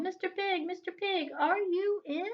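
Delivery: high sing-song call. Acted. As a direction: call up to the house, coaxing. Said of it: "I called".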